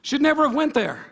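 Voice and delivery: loud, rising preacher calls; full-force preaching. Acted. Pastor urging the church to pray for others, preaching loud. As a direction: hammer each point home from the pulpit.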